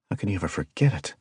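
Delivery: plain reading voice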